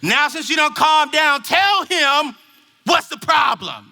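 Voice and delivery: mock scolding mom; exasperated mom. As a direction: order the daughter to get on with it. Mother scolds the crying daughter.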